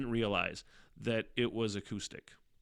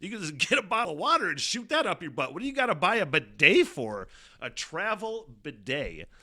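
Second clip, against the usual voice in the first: incredulous rant; 6 semitones higher, 5 dB louder than usual. Real, not acted. Ranting at the travel bidet idea.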